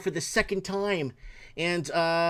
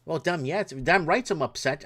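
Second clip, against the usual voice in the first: ordinary delivery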